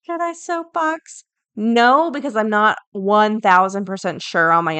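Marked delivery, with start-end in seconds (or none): 0.0-1.2 high, slow put-on musing voice; mock self-questioning. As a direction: toy with the idea, slowly and high. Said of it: "I'm like".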